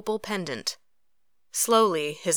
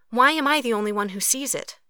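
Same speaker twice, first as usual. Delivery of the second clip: higher, exasperated question; exasperated demand; 6 semitones higher, 3 dB louder than usual. A narrator's reading. Katori asking Cruz about her mother's face, exasperated. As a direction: demand to know, upset.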